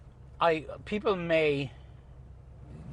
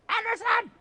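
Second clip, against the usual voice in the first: even higher second call. Real, not acted. Calling Anderson a second time, higher and more insistent. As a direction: call again, pushing it up further. Calls again, louder and higher.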